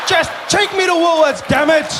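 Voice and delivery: high, loud mock yell at the car; mock shout. Driver yelling at his questioning GPS, mock shout.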